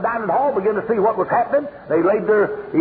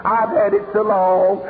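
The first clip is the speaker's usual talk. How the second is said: slow, lightly voiced excuse; mild mock member; 4 dB louder, slower than usual. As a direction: say it as the tired old member. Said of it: "somebody stand up and say".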